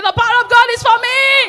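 high, loud declaration shouted out. Shown as a demonstration. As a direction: shout it for the whole church to hear. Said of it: "say it loud and scream it".

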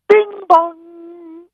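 sung high two-note chime, slow; mock ship chime. Comedian on radio mimics the ship's PA chime, high and sung. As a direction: imitate the cruise ship intercom ding-dong.

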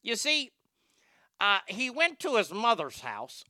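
hammed-up Chicago accent; put-on Chicago accent, storytelling. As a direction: tell it like a Chicago know-it-all. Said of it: "do the Chicago accent like that".

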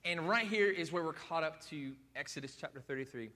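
usual speaking voice

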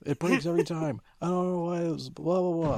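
put-on boy complaining that his toy always breaks; kid whining. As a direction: grumble like a cranky six-year-old.

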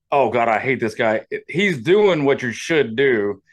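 normal talking voice

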